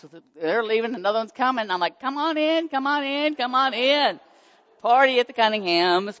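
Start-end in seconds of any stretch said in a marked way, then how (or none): 2.0-6.2 high, loud party-host call; excited welcome. Retold or imitated. Mom waving donors in, high festive party-host call. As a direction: call them in like you're throwing a party.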